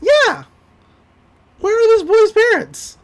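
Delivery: high, loud disbelief; outraged shout. Real, not acted. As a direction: yell it in comic outrage. Outraged over boy left in pool.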